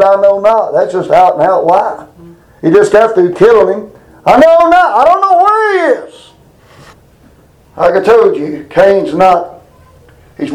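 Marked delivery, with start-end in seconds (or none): 4.2-6.3 acted denial. Cain answers God about his brother, acted high-pitched denial. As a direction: play Cain's denial high and emphatic. Acts out Cain's lying denial.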